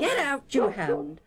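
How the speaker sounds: high, sharp scolding shout; shouted order. Acted. Esau to a barking hound, shouting angrily. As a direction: yell it at a dog underfoot.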